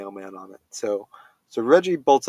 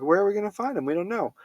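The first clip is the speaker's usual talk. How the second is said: high worried dog voice; 9 semitones higher, faster than usual. Retold or imitated. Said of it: "they're like".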